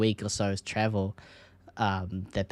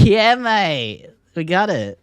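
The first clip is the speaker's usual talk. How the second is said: bright, higher, louder Aussie cheer; "Yeah, mate" pitched up and pumped; upbeat Aussie mate; 7 semitones higher, 5 dB louder, much slower than usual. Shown as a demonstration. Caster acting an Australian teammate when it goes well, cheering higher and louder. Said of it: "It's all in the expression".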